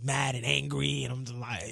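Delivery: ordinary talk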